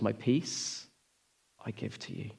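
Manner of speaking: 1 quiet gentle reply. Pretend. Jesus answers softly.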